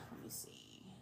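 quick whisper to herself; whispered aside. Real, not acted. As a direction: breathe it out under your breath.

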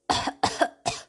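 rough smoky coughing fit. Acted. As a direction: cough out the smoke. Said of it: "preguntó el rey entre toses".

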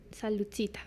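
soft whispered cheers; breathy whispered toast. Real, not acted. Quiet toast with a beer.

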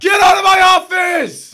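high, loud, slow shriek of panic; panicked scream. Acted. Host shrieking at the ogre in his office, high panicked scream. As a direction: scream him out of the room in mock terror.

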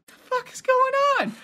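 very high, incredulous question; bewildered. Retold or imitated. Storyteller still reeling as the kicker runs off, bewildered. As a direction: pitch it way up, totally baffled. Acting out his baffled reaction.

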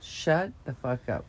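normal talking voice

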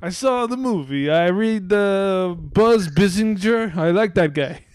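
badly faked Russian accent; botched Putin accent. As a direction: do a slow, comically bad Russian accent. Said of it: "my accent was really fucked up".

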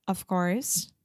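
normal talking voice

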